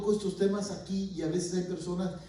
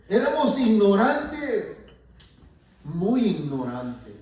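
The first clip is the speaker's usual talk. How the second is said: louder and higher, slow; loud emphatic; 4 semitones higher, 7 dB louder, slower than usual. Real, not acted. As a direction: declare it with force. Preacher confesses past ignorance.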